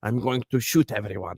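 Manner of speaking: a hint of mock Russian accent; Makarov. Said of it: "he sounds like Makarov from Call of Duty".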